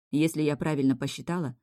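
ordinary delivery, nothing marked

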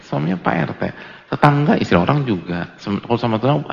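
ordinary talk

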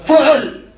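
فعل said as fu-ʕul; fu-ʕul, both vowels rounded in فعل; damma on first letter. Shown as a demonstration. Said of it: "بالضم".

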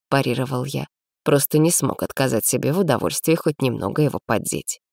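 ordinary delivery, nothing marked

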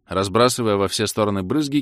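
plain reading voice

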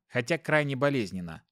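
ordinary delivery, nothing marked

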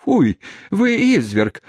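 high, slow shocked reproach; indignant cry. A narrator's reading. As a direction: cry out at his cruelty. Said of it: "воскликнула сердобольная хозяйка".

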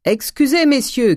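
loud, very high call; cried out. A narrator's reading. Master greeting his friends from the stairs, shouted. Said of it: "cria Grandet".

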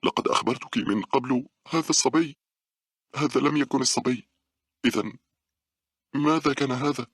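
high-pitched, frightened voice; fearful. A narrator's reading. Two friends argue over the mysterious sound, fearful. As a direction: argue in rising alarm, pitched well up. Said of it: "قالت باولا بخوف".

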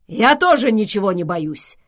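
loud, brave declaration; bravado. A narrator's reading. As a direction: declare it boldly. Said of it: "браво заявил Швайгер". (браво, громко заявить)

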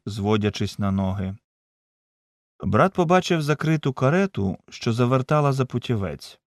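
ordinary delivery, nothing marked

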